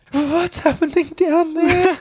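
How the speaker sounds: put-on a boy puzzled by his changing body; scared kid. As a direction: fret like a frightened child. S1 child voice.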